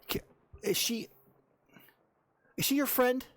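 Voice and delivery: high-pitched, halting challenge; indignant questioning. Acted. Volna demands to know who the girl is, high and indignant.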